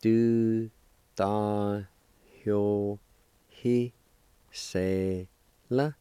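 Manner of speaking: each syllable alone with a long pause after it; teacher sounding out a word piece by piece; syllable-by-syllable demonstration. Shown as a demonstration. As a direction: break the word into slow, separate syllables. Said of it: "It's pronounced like this".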